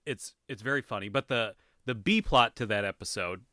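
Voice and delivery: usual speaking voice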